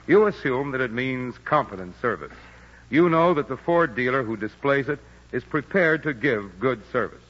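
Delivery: usual speaking voice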